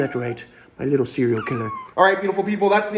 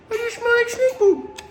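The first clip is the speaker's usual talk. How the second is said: even higher squeaky otter voice; otter's voice, higher. Pretend. Keeper voicing the otter teasing him about snake poop, squeakier. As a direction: push the otter voice up further. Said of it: "He's just saying".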